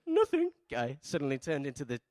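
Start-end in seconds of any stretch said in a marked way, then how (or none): 0.1-0.5 high-pitched mock squeak, pushed louder; exaggerated timid kid voice, very high; timid child. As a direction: squeak it like a scared little boy. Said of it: "And the timid".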